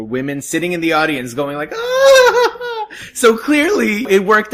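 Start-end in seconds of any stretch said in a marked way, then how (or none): 1.8-2.9 high, laughing swoon; delighted squeal. Retold or imitated. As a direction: squeal like a thrilled fan. Imitating women reacting in audience.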